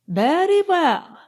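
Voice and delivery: put-on high, drawn-out foreign-accented reply; careful English, stretched out in a foreign accent. A narrator's reading. Count answering the lady's greeting, careful foreign accent. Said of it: "said the Count in careful English with an accent".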